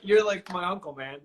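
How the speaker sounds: normal talking voice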